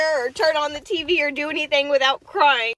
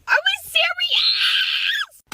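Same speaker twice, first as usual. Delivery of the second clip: slow, high shout of disbelief; outraged disbelief; much slower than usual. Real, not acted. Upset woman reacting to election results, disbelieving shout. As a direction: shriek it at the news in disbelief.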